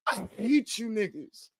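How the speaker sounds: slow, dropped, soft confession; soft, slow deadpan; deadpan punchline. Retold or imitated. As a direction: let the insult fall flat and quiet. Punchline of acted speech.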